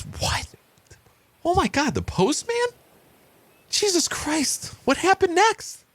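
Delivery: very high, hammed-up horror; appalled. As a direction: squeal in shock, then demand the rest. Husband appalled, demands the rest.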